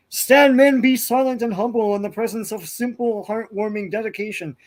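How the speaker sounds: hammed-up heroic speech over a coffee; grandly overstated thanks. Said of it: "way overstating just".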